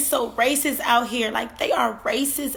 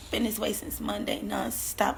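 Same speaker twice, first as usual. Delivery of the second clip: normal talking voice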